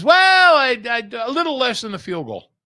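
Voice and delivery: high, loud put-on Jimmy the Greek voice with a Brooklynese tinge. Oddsmaker dodging rules on air, loud and high. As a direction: ham up the old oddsmaker dodging numbers. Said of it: "He says".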